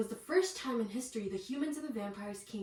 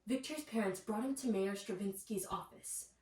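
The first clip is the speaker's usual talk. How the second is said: ordinary delivery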